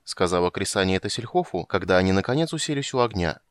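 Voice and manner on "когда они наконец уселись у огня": ordinary delivery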